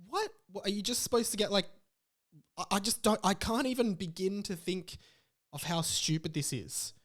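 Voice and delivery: normal talking voice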